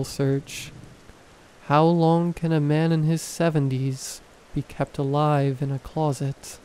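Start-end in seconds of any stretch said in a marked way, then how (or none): none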